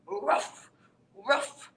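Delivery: barking then a yelp; dog barks. Acted. Reader voicing the stray dog barking and yelping.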